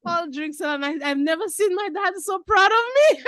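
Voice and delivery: high fast near-shouting joy, voice close to tears; overjoyed. Real, not acted. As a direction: burst out with happy emotion. Emotional peak about proud dad.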